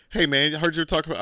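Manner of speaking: mock friend confronting you. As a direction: rush in like someone calling you out. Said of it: "they say".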